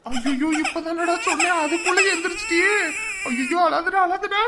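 high-pitched fretful coaxing; dismayed pleading. Acted. Parent to a crying baby, dismayed pleading. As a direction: plead with a baby who just woke.

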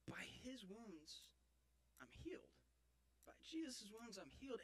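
very soft, reflective scripture quote; hushed reverence. Acted. Man recalling the book of Isaiah, hushed. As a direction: recite it gently, almost whispering.

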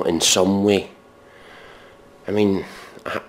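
ordinary talk, nothing marked

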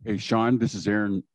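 usual speaking voice

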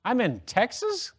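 high, slow, feigned amazement with a laugh; mock astonishment, high and laughing; mock surprise. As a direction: play dumb, wide-eyed and high. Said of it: "I went".

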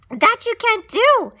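high, loud plea; alarmed protest. A narrator's reading. Elephant protesting the threat to the speck, alarmed and high.

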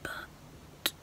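hushed whisper, mum asleep nearby. Real, not acted. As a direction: keep it to a whisper so nobody wakes. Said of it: "I have to whisper 'cause my mum's asleep".

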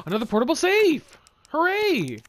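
high, loud delighted cheer; gasp, then cheer at a lucky find; excited. Real, not acted.

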